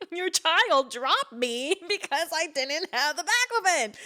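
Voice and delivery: high-pitched comic accusation through laughter; mock flipping out. Daughter mimicking Mom complaining to Dad, mock flipping out. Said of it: "like, flipping out".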